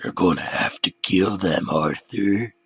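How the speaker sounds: lightly voiced demonic tempter; a hint of a demon. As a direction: coax him toward murder. Demon urges murder.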